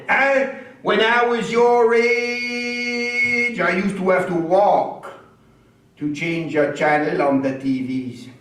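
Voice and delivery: put-on old Acadian storyteller, franglais, lively; Acadian character voice. Stand-up character telling the crowd about his wife, Acadian accent. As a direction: play Lucien grumbling about wife and doctor.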